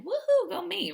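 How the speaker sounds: high, bright cheer for herself; excited cheer. Real, not acted. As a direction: cheer yourself on. Self-cheer on starting to date.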